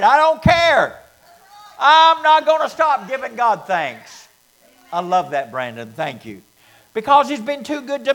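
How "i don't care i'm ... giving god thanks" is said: loud, defiant quote of a brother; defiant declaration. Retold or imitated. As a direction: shout it like you won't be stopped. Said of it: "like Brandon said Wednesday night".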